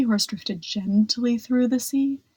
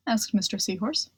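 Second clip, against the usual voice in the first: usual speaking voice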